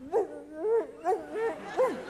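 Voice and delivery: high imitated dog bark; Lassie. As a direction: yelp twice like an alert dog. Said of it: "What's wrong, Lassie?".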